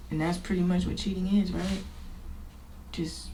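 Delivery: normal talking voice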